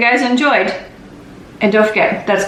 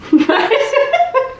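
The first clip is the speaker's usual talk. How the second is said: high giggle. Real, not acted. Huge melted bar.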